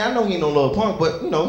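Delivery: ordinary talk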